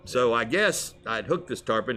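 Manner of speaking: ordinary delivery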